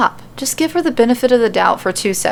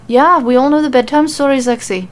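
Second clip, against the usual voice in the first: a bit of attitude; 4 semitones higher, 4 dB louder than usual. A narrator's reading. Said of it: "Nikki responds with a bit of attitude".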